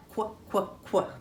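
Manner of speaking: "quack" cut down to a short "kwuh"; quacking duck sound. Shown as a demonstration. Teacher modelling the duck sound for children, "quack" as short "kwuh". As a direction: say "quack" as just its opening kw sound. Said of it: "Do that with me".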